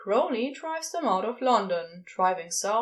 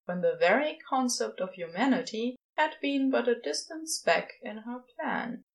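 ordinary delivery, nothing marked

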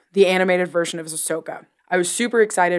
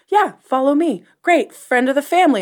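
higher, breezy put-on voice; mock cheerful Luke; 7 semitones higher, 4 dB louder than usual. Host voicing Luke leading Grogu away, breezy and cheerful. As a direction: chirp it like a too-friendly guide. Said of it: "he's like".

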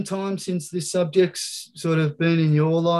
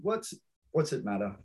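softer repeat; 10 dB quieter than usual. Retold or imitated. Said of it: "I love that one".

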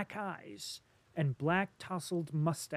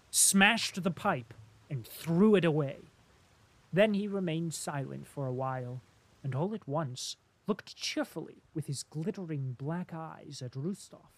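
ordinary delivery, nothing marked

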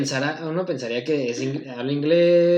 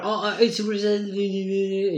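higher, slow drawn-out mock English; imitated English speaker; 4 semitones higher, much slower than usual. Clinic worker imitating patient starting to talk, high drawn-out English. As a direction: slur into vague English babble. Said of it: "Y ya empezó a hablar".

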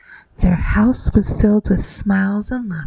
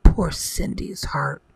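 ordinary delivery, nothing marked

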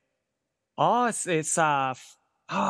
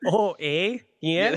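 mock Canadian with questioning lilt; jokey Canadian accent; 2 semitones higher, much slower than usual. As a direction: ham up a Canadian "eh?". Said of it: "I can pretty much do a Canadian accent".

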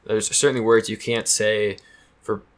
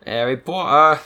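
mock accent; 5 semitones higher, 5 dB louder, slower than usual. Echoing the mock name.

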